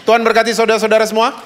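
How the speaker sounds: loud, fast, fervent blessing. Real, not acted. Pastor calling blessings over the congregation, loud and fast. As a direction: bless the congregation at full force. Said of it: "Kenceng banget kalau gitu-gituan ya".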